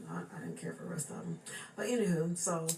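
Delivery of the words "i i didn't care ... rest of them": low, quick aside under breath; muttered aside. Real, not acted. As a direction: drop the voice for the aside. Aside about disliked family.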